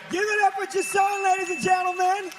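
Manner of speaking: rally the room for applause; hype shout. Real, not acted. Host hypes crowd for applause.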